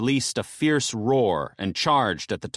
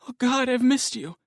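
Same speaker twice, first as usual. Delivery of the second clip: high, tearful woman's voice; overjoyed wife's high voice; 13 semitones higher than usual. Acted. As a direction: cry out in joy as the wife.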